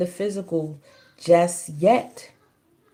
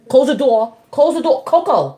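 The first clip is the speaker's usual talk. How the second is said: loud, higher repeated order; shouted command; 6 semitones higher, 7 dB louder than usual. Real, not acted. Mother yelling at the kids to close the door. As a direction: yell it across the house.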